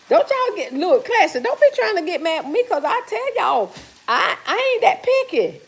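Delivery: teasing a viewer who called her picky; excited protest. Real, not acted. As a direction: protest playfully that you're not picky. Defending herself to viewer Classy.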